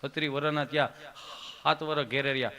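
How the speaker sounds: low, halting friend; Gabho. Acted. Friend airing his complaint to the comedian, low and halting. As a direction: grumble low, pausing after each phrase. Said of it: "ઈ કે".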